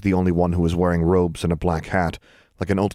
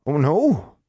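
lightly voiced Ozark granny; a hint of an old hill woman; country granny voice; 9 semitones higher, 3 dB louder than usual. A narrator's reading. Granny Pritchard speaks.

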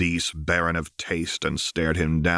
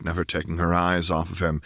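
ordinary delivery

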